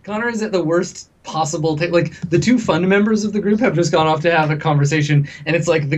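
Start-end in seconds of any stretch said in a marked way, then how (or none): none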